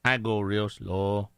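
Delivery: low, dragging cartoon sidekick; really slow buddy. Imitating Speedy's buddy for friends, really slow. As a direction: stretch every word, deep and slow. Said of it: "like, really slow".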